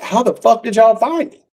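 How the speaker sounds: high-pitched baffled demand; incredulous question. Retold or imitated. Man asking police how they found him, high incredulous. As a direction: pitch it up in retold disbelief.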